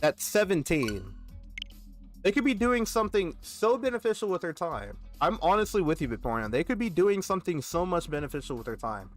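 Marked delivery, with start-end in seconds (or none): none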